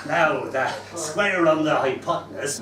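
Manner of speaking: lightly voiced pirate accent teaching maths; a hint of a pirate growl. Teacher joining the class game in a light pirate voice. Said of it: "that's why I'm talking in a pirate voice".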